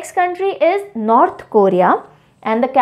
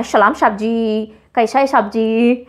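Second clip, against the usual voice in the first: imitated Nepali chowkidar accent, "s" turned to "sh"; movie Nepali watchman accent; 3 semitones lower than usual. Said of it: "है ना, ऐसे बोलते हैं".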